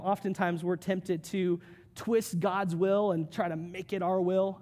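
ordinary talk

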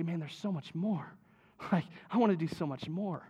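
soft and low, with a small laugh; God's gentle invitation. Acted. God softly inviting his people, tender and hushed.

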